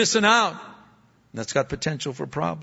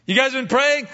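pitched-up accusing question; high questioning father voice; 10 semitones higher, 5 dB louder than usual. Retold or imitated. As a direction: ask it high and pointed. Father quoted asking his kids.

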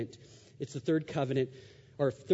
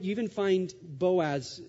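ordinary talk

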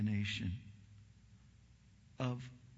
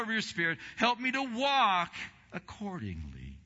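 high, loud fervent preaching into prayer; impassioned loud preaching; preaching at full force; 11 semitones higher, 10 dB louder than usual. Real, not acted. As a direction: lift it into a full-throated sermon and prayer.

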